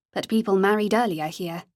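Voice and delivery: ordinary delivery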